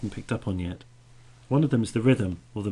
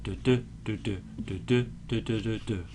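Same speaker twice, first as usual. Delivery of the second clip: "du, du" sung out as weak-strong pairs of syllables; beating out meter. Shown as a demonstration. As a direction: tap the line's rhythm out on "du". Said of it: "a foot of meter".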